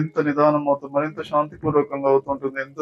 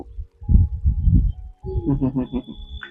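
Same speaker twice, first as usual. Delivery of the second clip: high, rippling little laugh; light high-pitched giggle; high chuckle; 12 semitones higher than usual. Real, not acted. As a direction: let out a long, high chuckle. Chuckles while guiding meditation.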